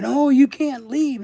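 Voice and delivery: slow, loud parody of a doctor's protest; mocking doctor. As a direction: imitate the doctors pleading.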